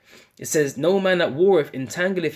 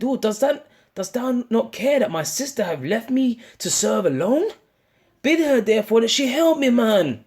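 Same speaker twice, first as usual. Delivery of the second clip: higher, whining plea to the Lord; high mock whine of a complaining woman; whiny complaining Martha; 7 semitones higher than usual. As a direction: play the put-upon sister griping. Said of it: "she started complaining".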